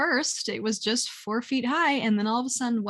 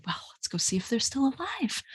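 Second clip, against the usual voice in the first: whispered aside; 4 semitones higher, 5 dB quieter than usual. Retold or imitated. Whispered aside about ducks.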